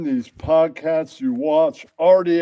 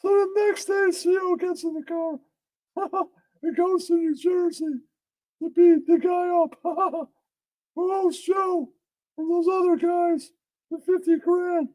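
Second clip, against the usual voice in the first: giggly story narration. Acted. Storyteller laughing at own tale, amused narration.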